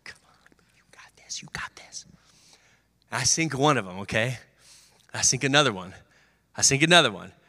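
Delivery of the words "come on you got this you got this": hushed, breathy whisper of support; whispered encouragement. Retold or imitated. Teammates around the free throw line, whispering encouragement. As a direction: whisper it so the shooter stays calm. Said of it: "They're like".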